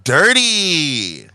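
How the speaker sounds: disgusted woman. Acted.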